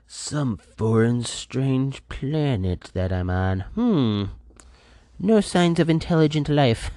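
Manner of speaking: mock alien musing. To listeners, a slow mock sci-fi musing. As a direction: muse like a lost space traveller. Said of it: "I'm like".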